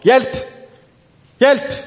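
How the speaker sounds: high, loud cries for help; shouting for help. Retold or imitated. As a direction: yell it out in panic. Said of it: "begynner å rope". (roper høyt om hjelp)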